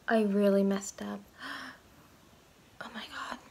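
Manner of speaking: gasping, whispered panic; breathless horror under breath; panicked whisper. Real, not acted. Panicking over botched haircut.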